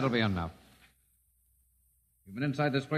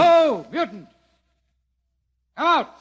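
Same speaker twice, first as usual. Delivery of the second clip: loud, high taunting call; high shouted summons at the door; 14 semitones higher, 9 dB louder than usual. Acted. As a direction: yell through the door to draw him out. Lieutenant calling through closed door.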